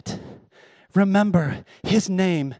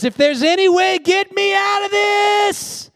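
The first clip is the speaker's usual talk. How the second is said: anguished cry; 12 semitones higher, 3 dB louder than usual. Retold or imitated. Jesus praying in anguish.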